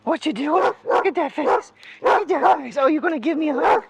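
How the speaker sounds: high, excited sing-along coo to a pet; high-pitched doting chatter; playful pet talk. Real, not acted. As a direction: fuss over a beloved pet, pitched high and a bit loud.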